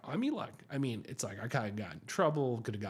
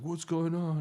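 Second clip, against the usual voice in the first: higher, louder baffled guy; puzzled dude; 6 semitones higher, 4 dB louder than usual. Retold or imitated. Driver finding his windshield messed, higher baffled voice. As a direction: ask it like a confused boyfriend. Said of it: "the dude's like".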